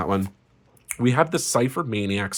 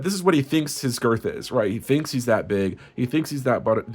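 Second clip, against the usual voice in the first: ordinary talk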